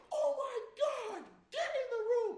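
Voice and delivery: very high, loud screech, hammed up; mock teacher yell. Teacher catches two boys roughhousing, mock screeching order. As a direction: shriek it like a scandalized teacher, exaggerated.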